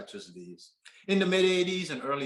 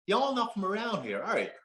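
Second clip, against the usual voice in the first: attempted Southern accent; 8 semitones higher than usual. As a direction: put on an amateur Southern US accent. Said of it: "attempt at my best Southern accent".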